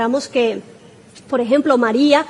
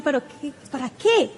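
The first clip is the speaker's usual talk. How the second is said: much softer, doubting questions; reluctant objection; 8 dB quieter than usual. Acted. Preacher voicing a hesitant churchgoer, softly doubting. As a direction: drop the volume like an unconvinced listener.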